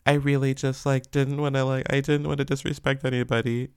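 high, sugary mock apology; fake innocent apology. Mocking a young star's pouty public apology, high and sweet. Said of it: "bat those little eyelashes and be like".

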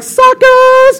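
very high gleeful jeer at the cousins; taunting sheep. Acted. Sheep jeers at its cousins left behind, high and gleeful. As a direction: shriek the taunt in a cartoon voice.

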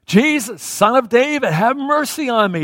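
high, loud pleading shout. Acted. Blind man refusing to miss his chance, shouting. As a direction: keep crying out, pitched high and loud. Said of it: "He just keeps shouting it".

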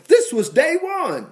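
high, drawn-out proclamation; emphatic excitement. Real, not acted. Preacher urges congregation to expect miracles now, excited. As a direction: hammer it home high and slowly.

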